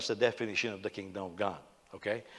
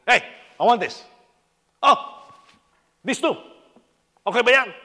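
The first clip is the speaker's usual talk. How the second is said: loud, high, abrupt demands; mock-imperious yelling at the waiter; brusque shouted ordering; 8 semitones higher, 12 dB louder than usual. Imitating brother ordering in Chinese restaurant, brusque shouting. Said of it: "and he's ordering it".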